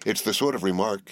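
plain reading voice

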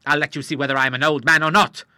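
put-on an old sailor spoiling for a fight; old Admiral challenging the moneylender to box, hotly. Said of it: "said the Admiral hotly".

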